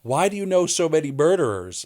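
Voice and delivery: high, loud mock outburst from mom; exasperated mom. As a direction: shout it like a fed-up mother. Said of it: "And she was like".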